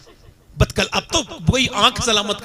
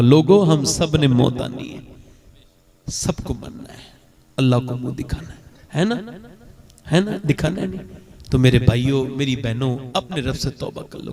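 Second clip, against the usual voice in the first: earnest preaching appeal; 7 semitones lower than usual. Acted. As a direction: plead with the listeners gently. Closing appeal to congregation. (धीमी, गहरी, नरम नसीहत)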